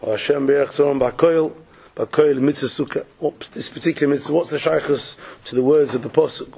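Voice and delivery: normal talking voice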